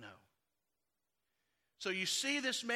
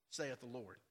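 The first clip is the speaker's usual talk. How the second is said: hushed solemn; 10 semitones lower, 10 dB quieter than usual. Acted. Solemn scripture quote ending.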